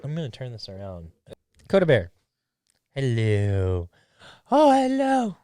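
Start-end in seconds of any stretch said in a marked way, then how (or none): 1.7-2.1 loud call to a pet; calling the dog. Real, not acted. 2.9-5.4 high, sing-song voice for talking to a dog. Real, not acted. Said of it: "my dog voice".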